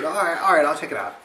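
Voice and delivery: calm her down, humoring her; placating. Retold or imitated. Humoring his upset friend on the phone, loud placating. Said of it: "And I said".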